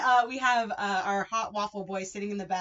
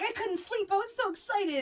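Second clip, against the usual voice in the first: put-on high, giddy kid voice; bouncy excitement, pitched up; excited; 12 semitones higher than usual. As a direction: squeal it out, way higher. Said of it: "And she's just like".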